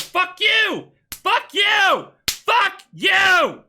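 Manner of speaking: high, very loud acted rage; hammed-up enraged shouting; mock furious yelling. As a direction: scream it like each word lands a punch. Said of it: "the guy gets so mad".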